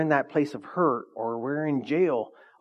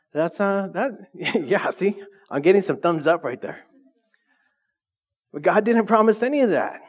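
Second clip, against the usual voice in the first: normal talking voice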